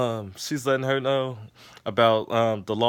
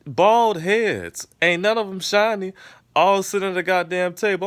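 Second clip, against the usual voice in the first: amused exclaiming; 9 semitones higher, 6 dB louder than usual. Real, not acted. Mocking the bald partners.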